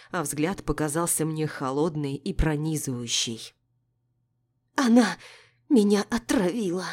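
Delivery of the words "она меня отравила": panicked whisper. A narrator's reading. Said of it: "прошептала императрица".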